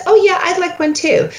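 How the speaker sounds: Phoebe line. Retold or imitated. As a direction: mimic a bright sitcom line, up high. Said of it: "When Phoebe starts with".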